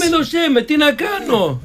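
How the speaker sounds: high-pitched, drawn-out self-defence; braying protest. Real, not acted. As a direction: protest up high, stretching the words. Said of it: "μην γκαρίζεις".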